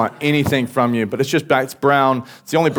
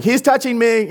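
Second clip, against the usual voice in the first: put-on high-pitched childish whine of complaint; angry kid tattling; 12 semitones higher, 4 dB louder than usual. Child tattles on brother in the car, high whine. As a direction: imitate an indignant kid, voice shooting up high. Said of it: "I got really angry".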